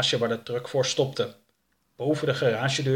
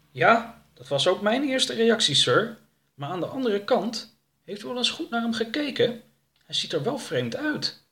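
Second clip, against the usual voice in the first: higher-pitched helper voice; hesitant henchman; 11 semitones higher than usual. Acted. Jake to his boss about the captive, tentative persuasion. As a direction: reason with the boss in a lifted voice.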